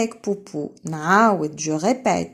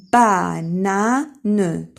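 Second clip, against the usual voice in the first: "بانان" drawn out slowly and louder; slow modelled word; 5 dB louder, much slower than usual. Shown as a demonstration. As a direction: stretch "بانان" for the class. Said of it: "teacher models word".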